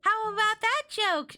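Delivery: put-on slow, very high girlish question; Chris. A narrator's reading. Chris asking Cody about her joke, high, drawn out. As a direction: ask for approval, drawn out and high. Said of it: "she asked Cody".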